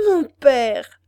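loud, high pleading plea; pleading cry. A narrator's reading. Daughter begs her father, loud high pleading. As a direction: beg her father with joined hands. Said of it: "joignant les mains".

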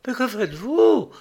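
high, alarmed question; acted horrified outcry; terrified cry. A narrator's reading. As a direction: cry it out in terror, voice leaping up. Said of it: "au comble de la terreur".

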